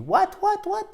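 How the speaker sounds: high, puzzled "what, what, what"; confused listener. Retold or imitated. Teacher mimicking a confused English speaker, high puzzled voice. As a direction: play a baffled native speaker who doesn't understand. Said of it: "mais personne ne vous dit".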